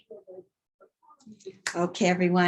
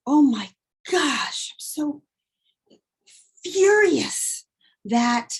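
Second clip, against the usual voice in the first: higher, building mock outrage; mock furious rant; 5 semitones higher than usual. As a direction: complain like a guest-let-down host.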